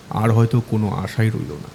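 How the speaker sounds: plain reading voice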